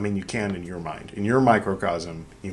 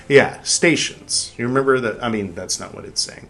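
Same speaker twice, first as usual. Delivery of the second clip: usual speaking voice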